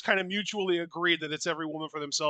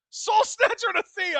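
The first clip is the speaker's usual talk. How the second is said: much higher, louder hype call; excited shout; 5 dB louder than usual. Real, not acted. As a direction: call out the move in a rush. Finishing move on screen.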